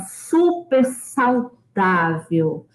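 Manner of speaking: very slow "super saudável"; drawn-out emphasis. Shown as a demonstration. Ênfase arrastada. ("super saudável" bem arrastado)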